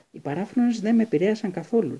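bursting to tell; excited. A narrator's reading. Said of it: "είπε ενθουσιασμένος ο Χάρη".

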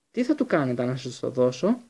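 usual speaking voice

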